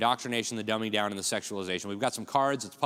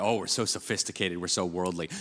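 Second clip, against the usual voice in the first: mock snooty; 5 semitones lower than usual. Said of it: "the International Baccalaureate".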